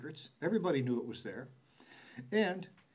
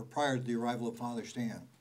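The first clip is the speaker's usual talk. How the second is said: usual speaking voice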